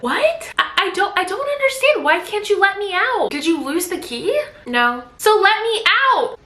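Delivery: high, confused, rising panic; upset wife. Retold or imitated. Trapped wife questioning her husband, high and rattled. Wife pleading through locked door.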